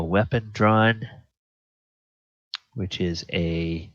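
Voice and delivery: ordinary talk, nothing marked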